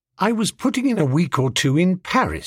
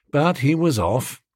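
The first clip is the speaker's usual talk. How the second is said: ordinary delivery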